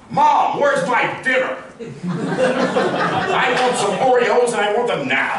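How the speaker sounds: put-on high, slow demanding child; whiny kid. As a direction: shout like a spoiled kid.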